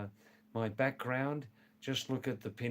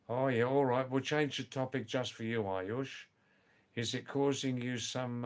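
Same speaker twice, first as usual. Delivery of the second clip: ordinary talk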